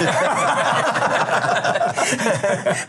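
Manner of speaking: high burst of helpless laughter; laughing. Real, not acted. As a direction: laugh it off in relief. Breaks off mid-sentence laughing.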